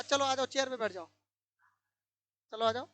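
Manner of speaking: usual speaking voice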